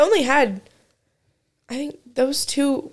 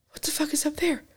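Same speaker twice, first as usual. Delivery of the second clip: mock amazed discovery; 6 semitones higher than usual. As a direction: gasp as if seeing the promised land. Spotting the Jack in the Box.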